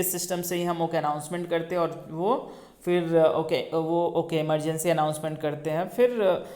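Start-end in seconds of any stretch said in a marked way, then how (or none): none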